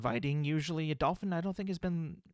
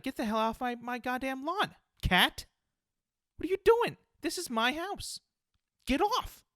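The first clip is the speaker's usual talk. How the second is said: angry yelling at a cat; 11 semitones higher than usual. Pretend. Homeowner screaming at a cat on his lawn, furious yell. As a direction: bellow like a fed-up homeowner. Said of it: "I'd be like".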